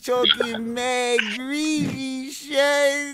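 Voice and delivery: hammed-up New York accent, mock crying. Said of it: "my New York accent".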